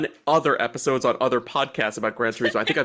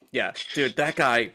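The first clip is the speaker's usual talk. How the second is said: ordinary talk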